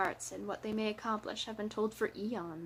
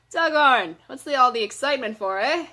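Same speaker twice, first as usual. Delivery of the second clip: loud, raised question to a comrade; calling out; 3 semitones higher, 12 dB louder than usual. A narrator's reading. Dwarf grabbing a comrade to question him, calling out loudly. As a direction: call out over a commotion, higher and brisk.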